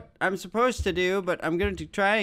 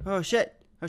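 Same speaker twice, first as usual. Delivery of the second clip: ordinary talk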